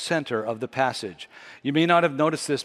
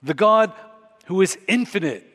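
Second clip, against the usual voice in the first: preaching climax; 4 semitones higher than usual. Real, not acted. Preacher urging men, women and youth, building to a passionate climax. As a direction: rise to the peak of the sermon.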